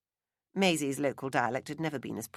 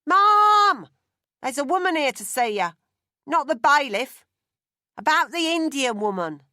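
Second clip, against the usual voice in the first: loud, high shout down the house; boy shouting news to his mother in the back room; bellowing; 10 semitones higher, 12 dB louder than usual. Acted. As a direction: keep hollering so mum hears from the back. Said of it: "he bellowed".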